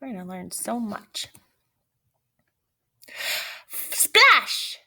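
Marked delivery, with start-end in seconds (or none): 3.7-4.8 high playful "Splash!"; splash sound effect. Acted. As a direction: shout it like a splash. Sound effect read from book.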